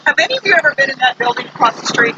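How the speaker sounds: ordinary talk, nothing marked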